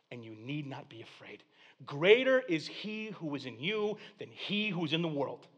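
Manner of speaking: normal talking voice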